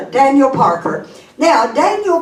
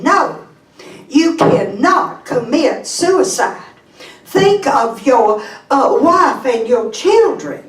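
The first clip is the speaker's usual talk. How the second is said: pleading Southern belle, pitched higher; Mississippi lady; 5 semitones higher than usual. Acted. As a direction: implore in a Mississippi accent. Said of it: "Story of a lady from Mississippi".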